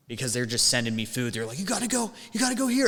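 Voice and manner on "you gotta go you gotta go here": high-pitched mock pestering coworker; frantic ordering. Kitchen staff sending busser off with food, frantic ordering. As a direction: imitate harried staff in a much higher voice.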